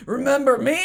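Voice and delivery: hammed-up villain confronting an old acquaintance; mock dramatic reveal. Acts out killer's dramatic reveal.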